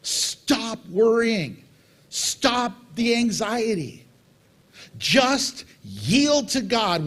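very slow, each word weighed; slow emphatic plea. Real, not acted. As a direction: spell it out slowly so it sinks in. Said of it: "I cannot stress this enough".

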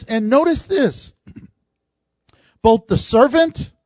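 normal talking voice

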